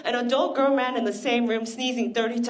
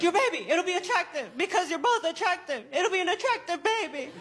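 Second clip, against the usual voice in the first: father-in-law; 8 semitones higher, 6 dB quieter than usual. Retold or imitated. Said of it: "He's like".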